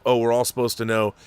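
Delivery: ordinary talk, nothing marked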